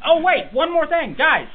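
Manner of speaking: high, loud sudden interjection; excited. Real, not acted. Podcaster stops friends before wrapping up, excited. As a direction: call out urgently to stop everyone.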